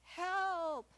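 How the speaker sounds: high, loud desperate cry; shouting again. Retold or imitated. Hiker calling again after no answer, loud high shout. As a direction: yell it up toward the top. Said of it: "Shouts again".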